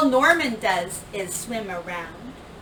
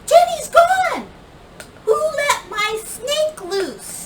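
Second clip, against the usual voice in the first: high, loud panicked shout; girl shouting over the class; 6 dB louder, slower than usual. A narrator's reading. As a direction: yell it out in alarm. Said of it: "Emily shouts".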